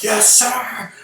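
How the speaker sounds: very high-pitched soldier's acknowledgement; called-out military reply. Retold or imitated. Soldier accepts the order to dance on mines, high mock shout. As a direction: call it out like an obedient soldier.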